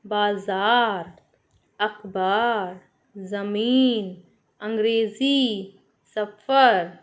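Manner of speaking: बाजार, अखबार, ज़मीन said slowly with ज़ and ख़ sounds; each nukta word drawn out for children to copy. Shown as a demonstration. Teacher reading nukta words aloud to class, slow and careful. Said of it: "नुक्त वाले शब्दों को बोलकर पढ़ते हैं".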